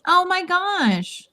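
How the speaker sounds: high, slow oh my gosh; amazed. Real, not acted. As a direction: marvel at it. Admiring the butterfly piece.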